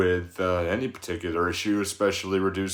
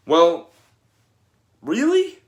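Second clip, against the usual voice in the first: much higher, louder mock-doubtful really; sceptical challenge; 8 semitones higher, 7 dB louder than usual. Coach to disappointed parents, questioning them, higher doubtful really. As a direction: challenge the parent's excuse with disbelief.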